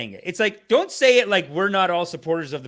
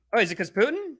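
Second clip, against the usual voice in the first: mock innocent, higher voice; playfully sarcastic, is it 'cause Putin; sarcastic question; 6 semitones higher than usual. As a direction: feign naive wonder.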